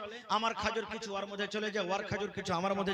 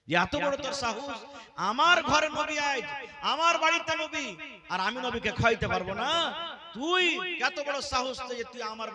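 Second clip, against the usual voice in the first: higher and louder, a furious wife's rebuke; jealous angry scolding; 5 semitones higher, 5 dB louder than usual. Acted. Aisha scolding the maid who brought the curry, angry and raised. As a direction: snap at the maid in jealous anger.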